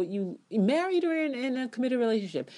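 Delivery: high, quick worked-up clause; heated. Real, not acted. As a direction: rush it, getting fired up. Heated point.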